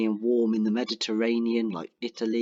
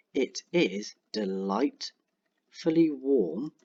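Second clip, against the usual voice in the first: slow whispered dictation; much slower than usual. Shown as a demonstration. Dictating description while typing.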